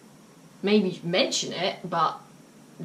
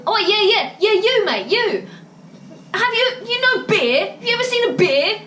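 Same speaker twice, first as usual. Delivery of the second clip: high, loud taunting call; loud mocking bloke; 13 semitones higher, 5 dB louder than usual. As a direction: jeer at him across the bar. Another customer mocking the complainer.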